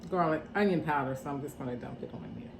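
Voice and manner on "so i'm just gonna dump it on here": muttering to herself. Real, not acted. Muttering aside while working.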